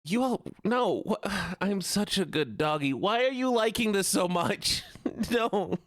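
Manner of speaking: high, whining protest; put-on whiny pleading. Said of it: "Winnie voice is almost done".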